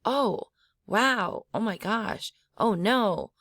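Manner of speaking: "oh", "wow", "oh my gosh", "oh no", each high then falling; high falling exclamations. Shown as a demonstration. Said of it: "high falling".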